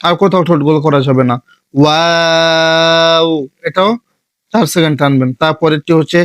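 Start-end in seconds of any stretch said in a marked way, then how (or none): 1.7-3.3 ওয়াও with rounded lips, drawn long; rounded Arabic letter. Shown as a demonstration. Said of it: "এই হরফের সময় ঠোঁটটা গোল হবে". (ঠোঁট গোল করে ওয়াও)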